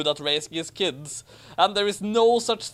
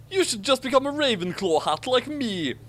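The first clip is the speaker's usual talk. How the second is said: mock blustering Draco; 10 semitones higher than usual. A narrator's reading. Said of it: "Draco sputtered blusteringly".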